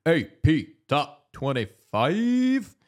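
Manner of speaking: slow booming announcer. Acted. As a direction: announce it like a big title. Echoes segment title dramatically.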